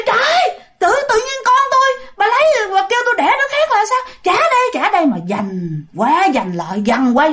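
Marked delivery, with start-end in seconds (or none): 0.1-5.1 very high, fast, indignant outburst; scolding mother. Acted. Mother demands her baby back from the ghost, indignant scolding. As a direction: scold at speed, pitched right up. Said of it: "mẹ bắt đầu chửi".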